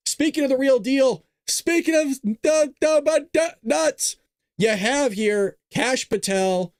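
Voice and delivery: high, drawn-out announcer build-up; big wrestling intro voice; hype announcer. Pretend. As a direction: stretch it out like a ring announcer. Hyped announcer build-up to Kash Patel.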